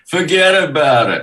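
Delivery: high, loud New York forget about it; New York accent. Retold or imitated. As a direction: toss it off like a mock New Yorker.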